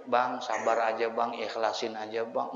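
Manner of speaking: low, soft, soothing words to a grieving man; calm him down gently; gentle consoling. Retold or imitated. Preacher softly consoling the weeping widower.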